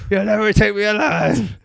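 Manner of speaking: high, loud action-hero yell; mock defiant shout. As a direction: holler like a commando's hammed-up last stand. Mimics commando shooting at the asteroid.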